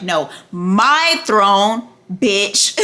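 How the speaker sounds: MY stretched out slow and loud; hammered stress. Retold or imitated. Queen correcting her husband, MY hammered slowly. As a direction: hammer "my throne" with long, heavy words. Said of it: "she would hammer in".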